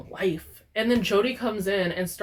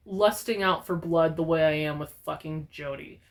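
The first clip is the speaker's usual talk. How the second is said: usual speaking voice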